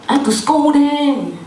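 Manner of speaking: high, exasperated grievance; hammed-up parental grumble; mock complaining. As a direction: complain like a put-upon parent. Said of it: "Then we go and complain".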